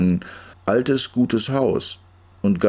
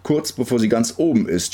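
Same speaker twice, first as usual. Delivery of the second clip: plain reading voice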